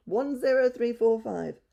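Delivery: high, loud and slow bird call; budgie calling slowly. A narrator's reading. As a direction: repeat the number slowly like a parrot. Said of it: "he called".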